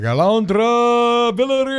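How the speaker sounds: drawn-out ring announcer call; boxing announcer. Retold or imitated. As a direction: announce the name like a title fight. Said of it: "say my name like a boxing announcer".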